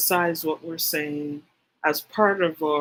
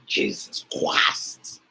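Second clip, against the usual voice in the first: strained, unvoiced imitation of a shout; forced rasping cry, held back in volume; mock preacher shouting; 10 semitones lower, much slower than usual. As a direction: stretch it slowly like a shouting prophet. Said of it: "there's a lot of shouting".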